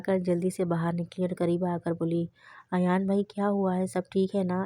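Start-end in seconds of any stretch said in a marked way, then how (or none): none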